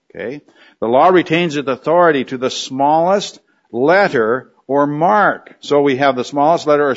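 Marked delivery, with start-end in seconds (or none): none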